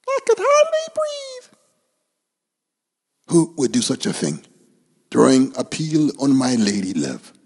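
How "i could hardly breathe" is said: very high, fussy little bird voice. Acted. Oxpecker telling her love about the banana peel, high and fussy. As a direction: play the upset little oxpecker.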